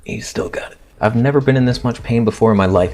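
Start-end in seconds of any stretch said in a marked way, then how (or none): 0.0-0.8 whispered teasing aside. Real, not acted. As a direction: breathe it as a secret joke.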